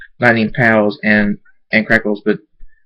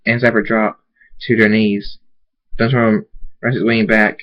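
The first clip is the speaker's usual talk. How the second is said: ordinary delivery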